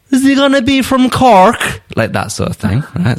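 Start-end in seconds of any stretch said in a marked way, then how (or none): 0.1-1.8 mock Cork accent, much higher and louder. Pundit imitates a Cork player, hammed-up Cork accent. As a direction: sing it up high like a Cork Irishman. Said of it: "I took you on a little tour of Ireland".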